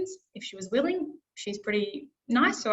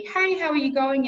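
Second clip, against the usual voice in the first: higher, louder friendly hello; cheerful message banked hello; banked greeting; 5 semitones higher, 4 dB louder than usual. Shown as a demonstration. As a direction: greet brightly as a recording. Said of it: "in my message banking".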